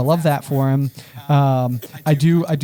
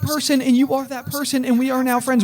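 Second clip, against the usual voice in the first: high, pleading overeager chatter; mock desperate eagerness; 10 semitones higher than usual. Lonely student asking a new acquaintance to lunch, mock desperate. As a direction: beg a new acquaintance to be friends, played up.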